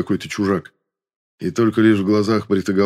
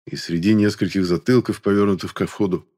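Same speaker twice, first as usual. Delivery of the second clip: usual speaking voice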